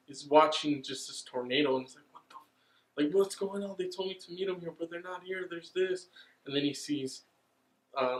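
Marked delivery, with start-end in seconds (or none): 2.1-6.1 higher, softer lightly voiced boy, whispery start; a hint of a lost kid puzzling; lightly voiced boy wondering where everyone went; confused little boy.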